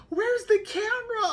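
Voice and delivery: very high, loud squeal; imitated excited wife. Wife seeing baby smile, high excited mock squeal. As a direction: shriek it in a put-on high voice.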